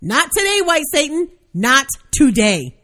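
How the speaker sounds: high, emphatic rebuff, slowing at the end; hammed-up high defiance; indignant retort. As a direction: fend it off with dramatic indignation. Indignant inner retort in story.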